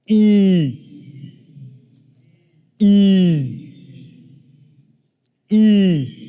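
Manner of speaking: silly exaggerated "E."; stretched E. Shown as a demonstration. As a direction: pull the lips wide and shout "E.". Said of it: "really stretch and look silly".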